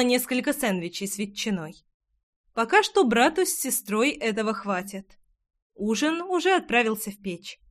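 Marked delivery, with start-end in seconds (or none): none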